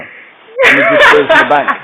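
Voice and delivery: words lost in a high, loud laughing fit. Real, not acted. Teller breaking up mid-story, laughing hard. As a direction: crack up through the line.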